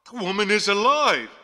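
high, loud cry of alarm; shocked exclamation. A narrator's reading. Rector aghast at the body in the tomb, high loud exclamation. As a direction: exclaim it in horrified disbelief. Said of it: "he exclaimed".

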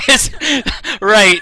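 words broken by high giggles; can barely talk for laughing; laughing hard. Real, not acted. Breaking up laughing at bug.

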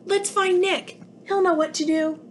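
fleeing alien suggesting they find Nick; hopeful alien. Acted. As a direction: offer the plan in a hurry.

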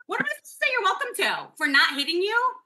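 high-pitched retold indignation; indignant disbelief. Pedestrian answering a rude woman, retold indignant disbelief. Retelling her indignant reply.

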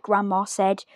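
plain reading voice

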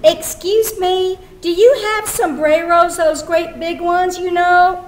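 lightly played customer calling over to a stallholder; market customer. Customer asks market vendor.

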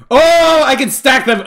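loud, high "oh" of discovery; sudden realisation. Real, not acted. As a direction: burst out with delighted understanding. Finds items can stack.

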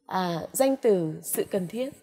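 normal talking voice

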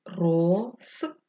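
рос... те, held apart; syllable-by-syllable dictation. Shown as a demonstration. Teacher dictating for pupils writing, syllable by syllable.

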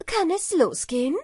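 impatient to start the race; eager hare. A narrator's reading. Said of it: "fragte er".

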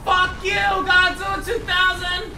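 high-pitched yelled curse; angry shout. Real, not acted. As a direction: yell abuse at your opponent. Cursing opponent in game.

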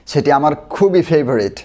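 ordinary talk

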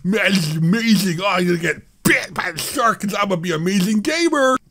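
high, hammed-up fanboy excitement; mock hype. Streamer pretending to sell viewers a shark skin, mock hype.

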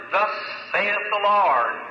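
slow, drawn-out proclamation; deliberate prophetic announcement; solemn decree. Retold or imitated. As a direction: lay each word down like a verdict. Retelling his message to the mother.

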